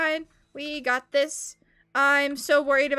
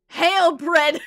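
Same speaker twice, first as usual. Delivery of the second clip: mock proclamation; 3 semitones higher, 9 dB louder than usual. Mock salute to the bread item.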